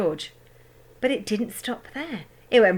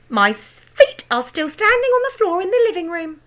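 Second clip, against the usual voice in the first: put-on cackling old woman; 11 semitones higher, 7 dB louder than usual. A narrator's reading. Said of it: "cackled the old woman".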